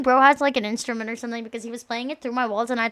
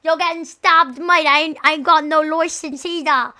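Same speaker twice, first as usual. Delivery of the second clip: a put-on British accent, louder; mock British roadman accent; 3 semitones higher, 5 dB louder than usual.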